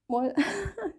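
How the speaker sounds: normal talking voice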